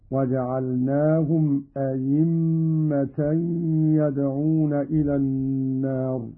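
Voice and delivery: أئمة said "ayimma", with a full y; hamza as pure ya. Shown as a demonstration. Teacher showing Qalun's other reading, أئمة as "ayimma". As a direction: turn the second hamza of أئمة into a clear y. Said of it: "إبدالها ياء خالصة".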